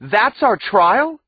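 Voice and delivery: high, loud outraged scream. A narrator's reading. Wisty screaming at the judge after the verdict call, outraged scream. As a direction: scream it at the court in disbelief. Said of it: "screamed Wisty".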